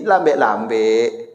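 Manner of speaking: drawn out slow and low; slowly. Shown as a demonstration. Preacher shows wives how to speak slowly, drawn out. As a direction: stretch each syllable gently. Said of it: "Kalau ibu mengecek".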